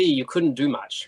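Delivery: usual speaking voice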